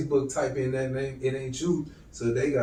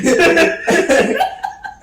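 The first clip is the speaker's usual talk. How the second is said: loud peal of high laughter; 10 dB louder than usual. Real, not acted. Friends joking over a page name, laughing loudly. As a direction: crack up hard.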